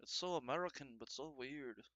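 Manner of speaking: a hint of bemused soccer fans gawking; quoting the fans in a put-on voice; mock Irish fan. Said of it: "They're like".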